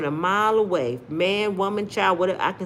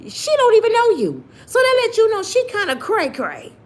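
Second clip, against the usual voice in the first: incredulous high; 13 semitones higher, 3 dB louder than usual. Real, not acted. Scolding passport bros.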